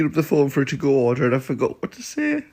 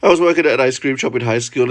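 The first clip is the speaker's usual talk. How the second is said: usual speaking voice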